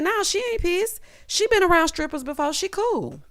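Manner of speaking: squeaky put-on carefree daughter; imitating Kandi. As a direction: wave it off in a high voice. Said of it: "Kandi said".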